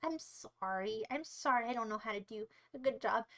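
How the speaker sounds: soft, low, mock-pitiful apology; sheepish apology. Streamer doubting his accent, meek apology. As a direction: shrink into a small, low sorry.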